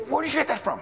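mock the professor's startled pitch jump; surprised professor. Professor caught off guard by the verses, mock surprised. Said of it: "he goes".

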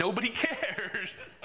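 amused, laughing agreement. Real, not acted. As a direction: laugh out the words.

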